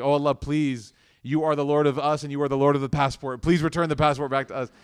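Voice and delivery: insistent plea, voice pushed up and out; retold begging. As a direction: imitate a group desperately begging God. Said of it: "We were begging Allah".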